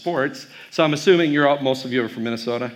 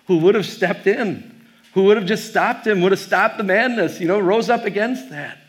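raised, pleading insistence; impassioned wish; 6 semitones higher than usual. Retold or imitated. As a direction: plead it with mounting urgency. Retold anguished plea at museum memory.

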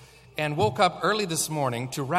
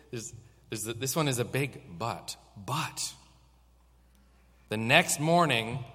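usual speaking voice

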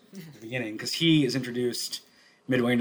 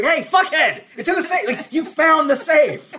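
very high, loud comic outrage; mock-furious shout breaking into laughter; exasperated yelling; 8 dB louder than usual. Club owner screaming at a detective who found the safe, exasperated.

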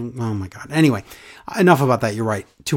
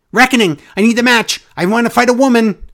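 higher, louder mocking whine; mock Woods demand; 6 semitones higher, 5 dB louder than usual. Host parodying Woods begging for a match, high and loud. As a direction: lampoon a silly wrestler's plea.

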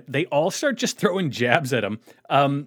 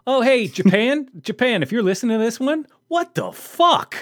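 mock outrage; 9 semitones higher, 4 dB louder than usual. Real, not acted. Exasperated call-out to Japan.

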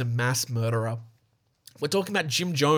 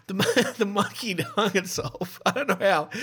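helpless laughter; 5 semitones higher than usual. Real, not acted. Host cracks up telling listeners about the monkey, laughing. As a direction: try to get it out while cracking up.

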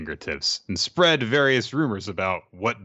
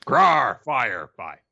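monster growl; 5 dB louder, much slower than usual. Acted. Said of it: "you're gonna be Frankenstein".

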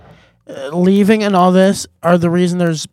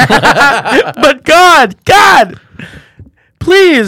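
loud, desperate prayer down a bad line; shouting into a phone; 10 semitones higher, 9 dB louder, much slower than usual. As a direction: yell your plea to God like the signal's bad.

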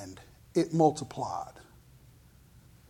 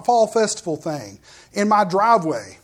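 usual speaking voice

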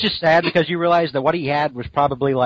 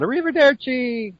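jaunty sing-song farewell; 10 semitones higher than usual. Retold or imitated. Spider-Man calling a farewell.

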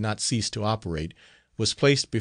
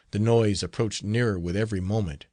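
ordinary delivery, nothing marked

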